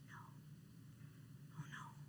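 very soft, barely voiced dismay; tiny, near-silent protest; hushed refusal. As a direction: breathe out a hushed, retold "no". Retold hushed reaction to boyfriend.

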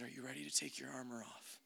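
very soft, hushed question; God's quiet invitation, retold; the Lord asking. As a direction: ask it gently, almost under the breath. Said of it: "he asked me this question".

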